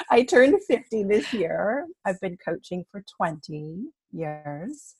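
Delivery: ordinary delivery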